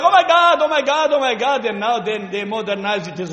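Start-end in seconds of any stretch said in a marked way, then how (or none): 0.0-1.6 high-pitched imitation, settling as it repeats; mock exclamation. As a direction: imitate people exclaiming, starting high and easing down. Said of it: "I'm just saying what they say now".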